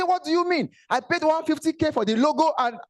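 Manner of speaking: high, huffy protest about the logo; mock offended businessman; indignant client. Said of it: "He say".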